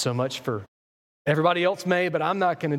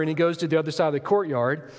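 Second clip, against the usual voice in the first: ordinary delivery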